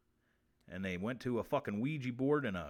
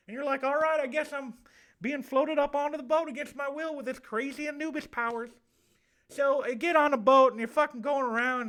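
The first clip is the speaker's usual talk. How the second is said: effeminate southern man with a lisp; 10 semitones higher, 5 dB louder than usual. Acted. Spirit contacted from the Egyptian afterlife, high lisping southern voice. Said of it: "it's me, the effeminate southern man with a lisp".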